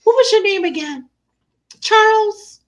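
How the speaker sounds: high, put-on questioning; exaggerated puzzled mom guessing names; feigned forgetfulness. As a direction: fish for the name with fake innocence. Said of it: "And he goes".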